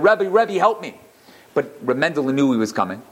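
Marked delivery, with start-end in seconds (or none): 0.0-1.0 higher, pleading cry of Rebbe, Rebbe; a retold desperate call, only lightly raised; mock scream for help. Man at the Rebbe's door, mild mock scream for help. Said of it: "started screaming".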